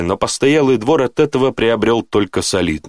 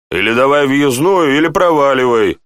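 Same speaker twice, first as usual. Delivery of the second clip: brusque, higher-pitched demand; rude; 5 semitones higher, 4 dB louder than usual. A narrator's reading. Guard demands a pass from the baron, rudely. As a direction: send him packing roughly. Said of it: "Страж разговаривал грубо".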